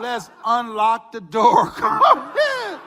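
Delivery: slow, laughing plea to open up; laughing coax. Retold or imitated. Coworkers begging him to unlock the door, laughing.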